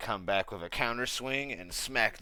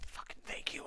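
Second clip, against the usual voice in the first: whispered grateful aside; 10 dB quieter than usual. Acted. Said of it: "Budge is in a completely different world".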